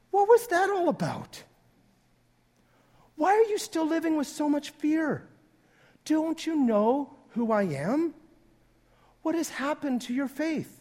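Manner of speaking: high-pitched, incredulous questions; exasperated questioning, pitched well up; acted chiding. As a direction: chide them in baffled disbelief. Jesus chides the fearful disciples.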